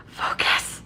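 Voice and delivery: breathy whispered plea. Real, not acted. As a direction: whisper the word "Focus". First take of focus.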